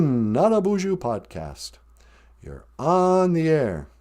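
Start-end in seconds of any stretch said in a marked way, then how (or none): none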